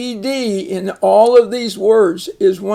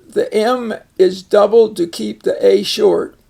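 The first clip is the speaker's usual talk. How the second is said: normal talking voice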